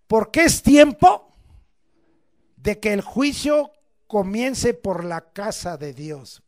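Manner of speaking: loud, slow, weighty reading; solemn scripture reading. Acted. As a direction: read it out like judgment.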